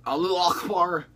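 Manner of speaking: loud, high shouted chant; hammed-up holler; mock battle cry. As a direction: belt it out as a joke war cry. Mock battle cry after bomb remark.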